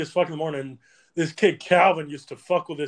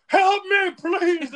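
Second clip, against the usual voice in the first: loud, high wailing plea; mock desperate plea; 6 dB louder, much slower than usual. Joe bleeding and begging, mock desperate plea. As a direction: imitate someone begging in a very high voice.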